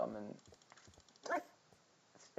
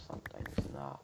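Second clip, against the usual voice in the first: voice crack; 10 semitones lower than usual. Real, not acted. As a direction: voice cracking mid-phrase. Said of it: "Holy voice crack".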